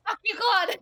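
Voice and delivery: high loud playful yell; yelling at Claude. Real, not acted. Streamer yelling at her mocking friend, high and loud. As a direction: bark it at him, laughing.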